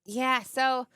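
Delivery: ordinary talk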